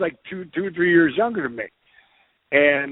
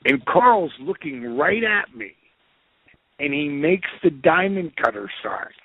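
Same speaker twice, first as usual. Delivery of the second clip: ordinary talk, nothing marked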